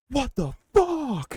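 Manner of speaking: very high, slow disbelieving cry; acted freak-out. Host acts out the father waking up, high drawn-out freak-out. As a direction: stretch it out in mock waking terror. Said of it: "he's, like, fucking freaking out".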